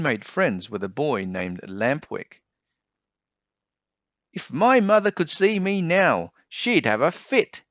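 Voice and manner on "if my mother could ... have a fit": put-on high, louder naughty kid bragging; cheeky boy voice. A narrator's reading. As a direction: boast like a boy off the leash. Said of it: "he explained to Pinocchio".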